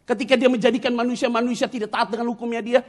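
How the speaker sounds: high, loud sermon delivery; full-force preaching. Acted. Preacher to the congregation on God's disappointment, full-force preaching.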